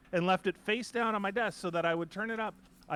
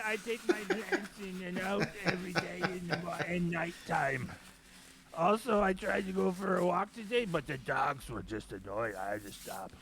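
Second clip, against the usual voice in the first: a hint of a creaky old man; lightly put-on old geezer voice; 3 semitones higher than usual. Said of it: "I don't know where that voice is coming from".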